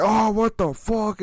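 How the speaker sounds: slow, high mock surprise at a snakebite; mock shocked. Mocking a snake handler who just got bitten, slow high surprise. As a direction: drawl it out like a stunned snake handler. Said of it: "they're like".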